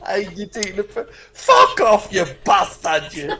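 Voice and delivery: a hammed-up angry northerner; mock northern rant. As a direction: play the hard northerner having a go. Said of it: "so do a northern slang".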